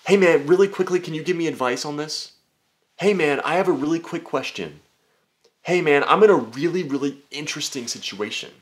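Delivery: high, eager mock voice; mock DM asker. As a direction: beg for quick advice in a pushy way. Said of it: "you're just like".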